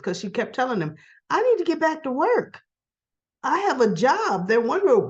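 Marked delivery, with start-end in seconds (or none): 1.3-4.5 higher, emphatic retold insistence; insistent. Captive keeps telling him about work, mock insistent. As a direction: press the point, pitch raised on each phrase. Acting her insistence on leaving.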